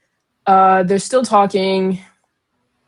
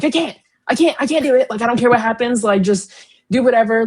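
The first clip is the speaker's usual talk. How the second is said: higher, pleading I-can't voice; impersonating Catelyn; 4 semitones higher, faster than usual. Retold or imitated. Friend imitates Catelyn refusing Master Luwin, pleading higher. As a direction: whine it out like a mother who won't leave her son. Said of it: "That's a great impersonation".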